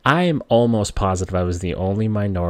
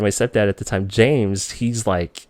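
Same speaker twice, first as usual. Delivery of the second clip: ordinary delivery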